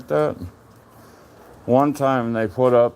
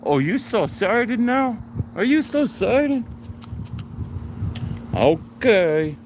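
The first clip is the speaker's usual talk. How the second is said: dog talk; 9 semitones higher than usual. Real, not acted. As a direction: tease the excited dog, climbing higher.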